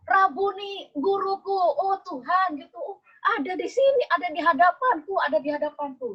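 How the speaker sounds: high, overjoyed cry of recognition; Mary Magdalene recognising Jesus, overcome with joy; joyful recognition. A narrator's reading. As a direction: burst out in tearful joy at seeing him. Maria recognizes risen Jesus.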